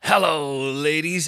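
imitation of an over-confident club act; Dino Bean. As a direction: stretch out a mock-seductive comic's hello. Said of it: "And then he goes".

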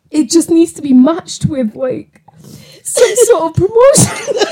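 high-pitched tearful wobble, mimicking a breakdown; voice shaking, tearful. Retelling a tearful promotion plea to the director, voice shaking. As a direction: re-enact pleading on the verge of tears, laughs breaking through. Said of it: "your voice is shaking".

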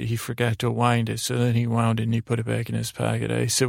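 plain reading voice

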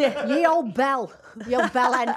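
loud, laughing through the name; helpless giggle on a silly name; can't say it without laughing. Real, not acted. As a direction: crack up while saying it. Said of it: "sorry, I can't say it without laughing".

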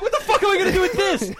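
high, loud, laughing outrage; laughing indignation. Retold or imitated. Customer to worker, laughing indignation. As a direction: throw the question at him, laughing.

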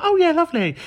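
very high, put-on delight; fake enthusiasm. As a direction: go along with it in a high gush. Retells faking enthusiasm to go along.

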